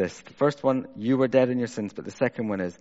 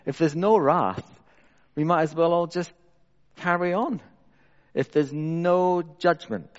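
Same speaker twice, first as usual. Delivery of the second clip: higher-pitched rhetorical challenge to the room; impassioned preaching; 7 semitones higher, 4 dB louder than usual. Real, not acted. Preacher challenging congregation about salvation without wrath, impassioned. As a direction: preach it with rising insistence, leaning on key words.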